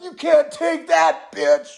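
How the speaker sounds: high, slow, taunting shout; trash-talk shout. Retold or imitated. Military people taunting the enemy, shouting high and slow. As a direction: yell it like a gloating gamer. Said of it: "military people in the U S were like".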